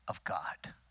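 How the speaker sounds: low hushed whisper on the final words. Real, not acted. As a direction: let it fall to a whisper. Preacher hushes on key phrase.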